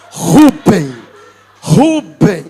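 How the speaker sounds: loud called name, pitch low; mock calling out. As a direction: shout across the church for Rubem. Calling out to the Wi-Fi guy.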